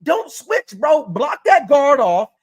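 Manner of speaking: ordinary talk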